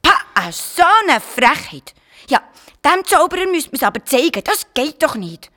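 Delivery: put-on high, loud indignant child voice; indignant outburst. Garnelli fumes at the wicked wizard, high and indignant. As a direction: huff in outrage at the wizard.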